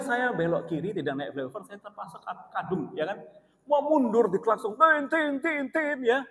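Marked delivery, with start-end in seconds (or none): 4.7-6.0 horn honks. Retold or imitated. Imitating car horns.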